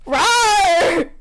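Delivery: playful growled rawr; a mock roar. Narrator teasing friend's reading with a mock rawr. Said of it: "You gave him raw energy".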